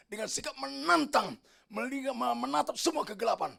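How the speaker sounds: high, intense sermon delivery; impassioned preaching. Real, not acted. Preacher describing noon at Golgotha, impassioned preaching. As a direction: build the list with rising fervour, stretching key words. Preacher building at full force.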